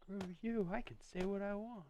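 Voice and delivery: high mocking retort; high-pitched mock reply; mock K-Bad. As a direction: squeak out a cheeky comeback. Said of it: "K-Bad's gonna be like".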